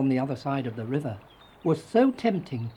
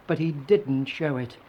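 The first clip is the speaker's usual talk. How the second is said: usual speaking voice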